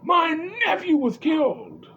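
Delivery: very high, full-voiced shriek; imitated scream. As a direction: scream it as her outburst, mimicking. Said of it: "And she screamed".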